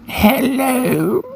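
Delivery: put-on very high old-lady voice; high-pitched old woman on the phone; old woman's voice. A narrator's reading. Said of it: "An old woman's voice answered".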